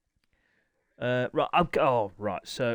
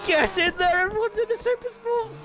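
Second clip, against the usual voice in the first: high, loud triumphant yell; cheering shout; 4 dB louder than usual. Real, not acted. Commentator cheering all riders into Super Sports. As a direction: yell with pure delight. Celebrating everyone in class.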